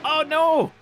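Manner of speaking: sudden high, loud yelp of dismay; real shocked exclamation; alarmed cry. Real, not acted. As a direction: cry out as the car spins. Reacts to a crash.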